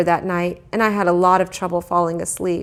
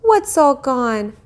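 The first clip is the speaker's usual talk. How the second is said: slow questioning mother; 7 semitones higher, 3 dB louder, much slower than usual. A narrator's reading. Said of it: "my mother asked".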